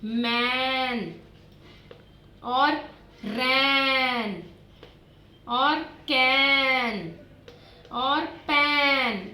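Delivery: each rhyme word stretched long and slow; slow drawn-out teaching voice; slowly drawn out. Shown as a demonstration. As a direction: draw every word out for the children. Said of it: "N वाले words लिखने हैं".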